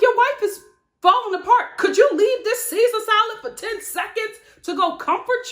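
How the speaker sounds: high, loud, exasperated plea; incredulous mock scolding. Host scolding a husband glued to his salad while his wife cries, incredulous.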